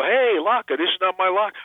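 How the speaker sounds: high-pitched fan calling out a catchphrase; imitated fans. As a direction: quote it back eagerly, pitched well up. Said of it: "people come over and go".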